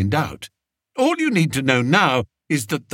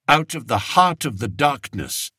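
ordinary delivery, nothing marked

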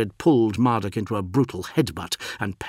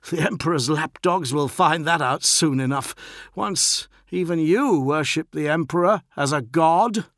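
higher, provocative jibe; defiant postulant; 8 semitones higher, 5 dB louder than usual. A narrator's reading. Bloodied postulant needles his captain, defiant. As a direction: needle the captain with raised pitch.

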